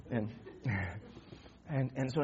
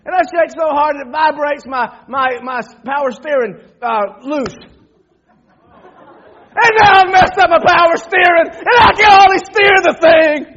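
high, very loud acted hollering; hammed-up outraged shouting; mock-furious rant; 11 semitones higher, 18 dB louder than usual. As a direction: yell in exasperation at the broken truck. Still ranting about the shaking truck.

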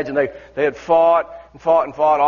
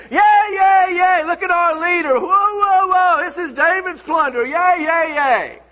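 mock crowd hailing their hero; cheering crowd; 10 semitones higher, slower than usual. Crowd cheering David acted.

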